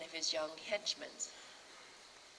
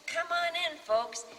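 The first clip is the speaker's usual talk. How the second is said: loud, high booming welcome; hammed-up hearty invitation; 1 loud host welcome; 12 semitones higher, 8 dB louder than usual. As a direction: wave the crowd in like a showman. Repeated invitation.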